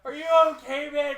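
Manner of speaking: loud, high-pitched outcry at a friend; alarmed shout. Retold or imitated. Checking on friend throwing up in a cab, alarmed yell. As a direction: yell in shocked concern.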